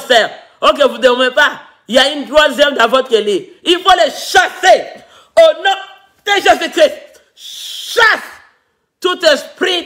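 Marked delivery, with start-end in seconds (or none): 3.6-7.2 fervent shout. Real, not acted. Preacher casting out spirits. 7.3-9.8 a high, breathy, unvoiced cry of Chasse; whispered high Chasse; breathy command. Real, not acted. Preacher commanding spirits out.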